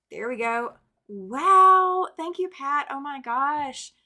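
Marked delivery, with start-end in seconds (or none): 1.1-2.1 much higher, much louder burst of joy; a real gasp of thrilled surprise; real delighted wow. Real, not acted. Host thrilled by a viewer's big gift, real excited wow. Big Super Chat arrives live. 2.2-3.9 excited thanks. Real, not acted. Thanking donor, overwhelmed.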